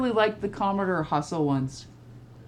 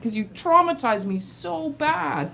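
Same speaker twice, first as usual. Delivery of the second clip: mock whiny complaint; 7 semitones higher, 5 dB louder than usual. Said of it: "I'm like".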